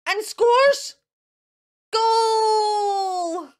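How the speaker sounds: storyteller cheering the goal. Acted. Mister Wolf scores at football.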